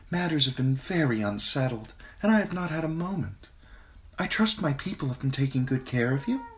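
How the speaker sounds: put-on a queen cooing to a frightened girl; queen Cersei, sweet and smooth. A narrator's reading. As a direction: Cersei soothing Sansa in a honeyed voice. Narrator voices Queen Cersei.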